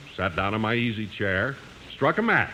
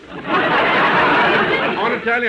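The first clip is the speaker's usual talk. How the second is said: high, loud words bursting through a laugh; 11 semitones higher, 7 dB louder, much slower than usual. Acted. Wife to husband about the table, laughing. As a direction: giggle it out slowly. Party prep banter, laughing.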